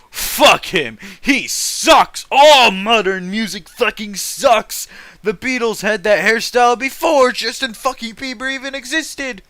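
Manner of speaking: mock angry rant. Streamer voicing an imagined hater of modern music, shouting. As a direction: rant like a furious music snob.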